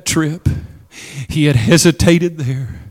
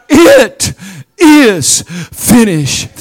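high, slow, loud declaration; slow triumphant It is finished; 3 semitones higher, 4 dB louder, much slower than usual. Acted. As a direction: proclaim it in triumph. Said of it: "we ought to rejoice".

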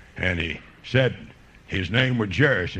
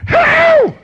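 high, loud whoop; hollering; 13 dB louder than usual. Retold or imitated. Woman hollering out in church after her healing, high whoop. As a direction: let out a piercing holler. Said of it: "hollering like that".

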